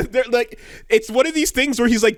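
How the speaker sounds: normal talking voice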